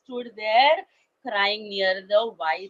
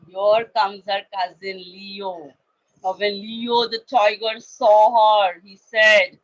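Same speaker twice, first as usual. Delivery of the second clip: plain reading voice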